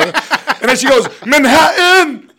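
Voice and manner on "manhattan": high, loud, drawn-out mock scream. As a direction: imitate someone screaming a punchline. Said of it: "She starts screaming her, her jokes".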